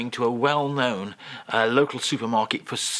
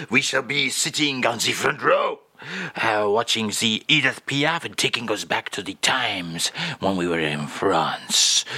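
[name]; 3 semitones lower than usual. Acted. French guest pictures the front row, nostalgic mock [name]. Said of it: "And Charles".

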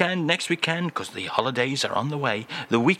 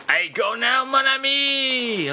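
mock [name]; 11 semitones higher than usual. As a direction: sing out mon ami in hammed-up French. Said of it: "he always has to pop back".

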